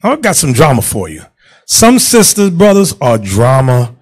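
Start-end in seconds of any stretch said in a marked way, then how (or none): none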